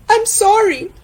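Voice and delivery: acted remorseful friend; Tana, apologetic. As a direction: apologise sadly to your friend.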